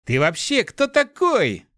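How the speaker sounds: high, drawn-out furious outcry; outraged, slow exclamation. A narrator's reading. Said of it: "яростно воскликнул мальчишка".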